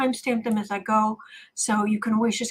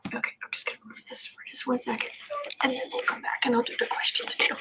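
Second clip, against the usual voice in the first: quick whisper; 13 semitones higher, 7 dB quieter, much faster than usual. Real, not acted. Whispering while moving sleeping pet.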